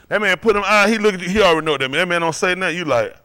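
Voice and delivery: normal talking voice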